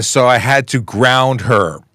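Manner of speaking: milk every word of the pun; drawn-out punchline. Acted. Reading dad joke punchline aloud.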